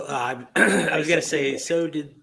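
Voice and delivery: ordinary talk, nothing marked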